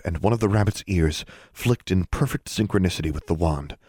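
ordinary delivery, nothing marked

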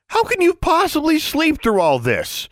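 very high, loud exasperated yell; shouting at a roommate who won't wake. Acted. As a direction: yell at the sleeping girl. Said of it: "she yelled".

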